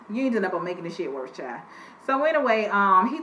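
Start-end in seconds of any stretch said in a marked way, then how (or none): 0.1-1.6 faster, softer repeat. Shown as a demonstration. Host dismissing the woman's excuses, fast and quieter. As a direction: rattle off "ain't doing nothing" fast and softer.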